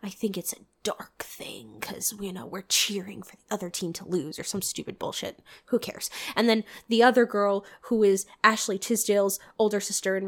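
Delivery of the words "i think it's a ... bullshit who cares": hushed secret-telling whisper. Retold or imitated. Goth cheerleader confessing a dark secret, whispered. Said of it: "She's like".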